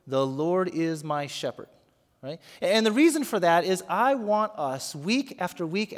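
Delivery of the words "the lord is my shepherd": slow, deliberate The Lord is my shepherd with Lord leaned on; teaching the phrase to say along; slow demo phrase. Shown as a demonstration. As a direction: spell out the words slowly for them to repeat. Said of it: "So just the words".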